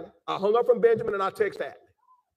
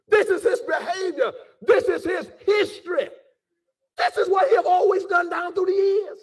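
raised, higher-pitched preacher's voice; impassioned preaching; 7 semitones higher than usual. Real, not acted. Preacher warns those who may be surprised, impassioned preaching. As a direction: press the point home to the congregation.

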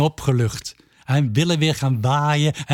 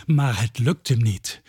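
usual speaking voice

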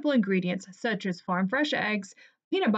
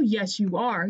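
ordinary delivery

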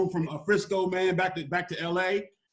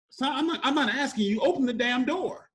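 higher-pitched imitation of a fed-up mother; stern mom; 7 semitones higher than usual. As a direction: act out mom ordering her son. Acting stern mom giving an order.

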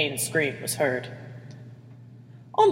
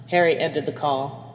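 ordinary delivery, nothing marked

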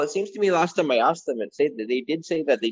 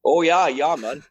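mock Canadian accent; 2 semitones higher, 3 dB louder, slower than usual. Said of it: "such a fucking Canadian".

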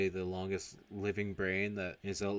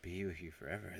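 much softer, pondering repeat; musing echo; 2 semitones lower, 9 dB quieter than usual. Retold or imitated. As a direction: say it quietly as if mulling it over. He echoes the wish, musing.

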